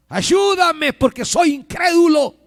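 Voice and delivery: desperate high, loud cry for help; grito desesperado. Acted. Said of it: "Pero un grito desesperado". (grito desesperado pidiendo ayuda)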